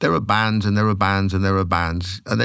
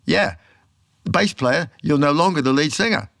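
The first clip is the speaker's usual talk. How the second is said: retold amazement; 7 semitones higher than usual. People hearing his singing, retold.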